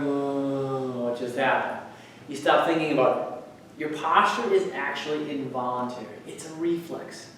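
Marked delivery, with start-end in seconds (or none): 0.0-1.8 long, sinking "uh", then low voice; mock deflating, pitch sliding down; acted slump. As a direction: let the voice sag as if posture collapses. Acts out posture slumping after effort.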